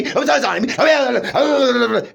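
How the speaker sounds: laughing through a high, slow mock quote; mock quote dissolving into laughter; laughing mockery. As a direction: drag it out while cracking up. Mocking her, laughing through it.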